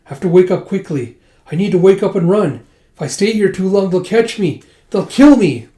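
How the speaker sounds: urgent, building from uneasy to high and loud; rising panic. Retold or imitated. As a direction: build the fear to a loud final cry. Yona's nightmare of being caught.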